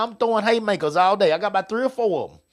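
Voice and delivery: ordinary talk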